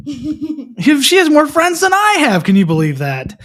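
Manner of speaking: high, loud, drawn-out mock outrage; mock disbelief. Teasing a child who has many friends, mock disbelief. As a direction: stretch it out in hammed-up astonishment.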